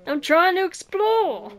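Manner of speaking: normal talking voice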